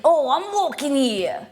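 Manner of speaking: high, hammed-up New Zealand accent. Streamer caricaturing accents for viewers, New Zealand accent. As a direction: push the pitch up, exaggerated New Zealand impression. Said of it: "Then you got the New Zealand".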